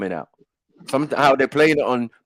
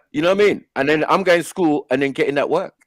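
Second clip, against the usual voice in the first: usual speaking voice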